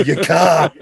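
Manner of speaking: put-on hammy thick Boston accent; exaggerated Boston accent. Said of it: "exaggerate his own Boston accent".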